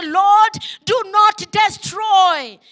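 loud, slow pleading cry to the Lord. Acted. Preacher leading the church in prayer, a loud pleading cry. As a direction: call it out to God at full voice. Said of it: "can we cry out today and say".

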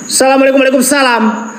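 high, ringing greeting to unseen spirits; calling out to spirits. Real, not acted. Ghost hunter greets the well's unseen guardian, calling out high. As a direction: call out high into the dark, lifting on the last phrase.

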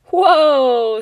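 loud, high whoa of wonder; be dazzled by what you see; a big impressed whoa; amazed whoa. Acted. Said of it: "They all whooped and hollered".

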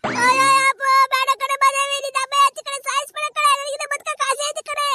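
high, loud, crying screams for help; loud terrified wailing cries; screaming for help. Acted. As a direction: shriek and sob as if being stabbed. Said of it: "ಇದ್ಯಾಕಪ್ಪ ಇದು ಹಿಂಗ್ ಕಿರ್ಚ್ಕೊಂತವಳೆ".

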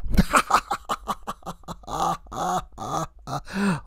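shrill cackle at his own joke; high cackling vampire laugh. Acted. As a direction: cackle with glee.

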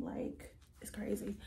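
soft unvoiced whisper; whispered aside. Real, not acted. Vlogger whispering an aside to viewers, hushed. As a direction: drop to a breathy aside to viewers.

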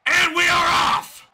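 high, loud, drawn-out call; hyped ringside announcer; excited announcer shout. Real, not acted. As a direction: launch the action with a big yell. Announcing action resumes.

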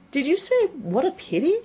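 high-pitched, questioning rabbit. A narrator's reading. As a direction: ask it in a high little voice.

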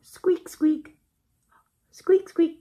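high, loud squeaks; high mock mouse squeaking. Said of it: "The mouse says".

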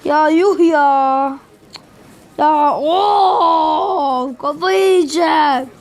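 slow, drawn-out whining cry; half-laughing lament; mock wail. Real, not acted. Gamer losing the battle, drawn-out mock wail.

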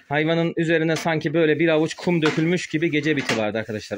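usual speaking voice

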